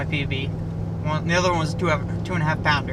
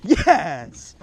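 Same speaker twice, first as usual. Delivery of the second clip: excited cheer; 3 semitones higher, much slower than usual. Real, not acted. Celebrates landing big fish.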